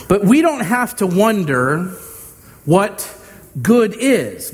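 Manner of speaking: normal talking voice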